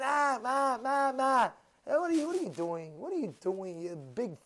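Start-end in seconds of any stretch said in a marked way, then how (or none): 0.0-1.5 high mock whine; mock complaining. Preacher mimics people who complain, babyish whine. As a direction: imitate a whining baby.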